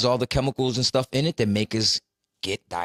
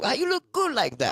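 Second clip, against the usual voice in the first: mock relative; 12 semitones higher than usual. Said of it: "And they start asking you questions".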